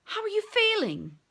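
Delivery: worried friend. Acted.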